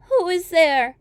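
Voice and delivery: put-on an old woman calling through the door; old grandmother. Grandmother calls out.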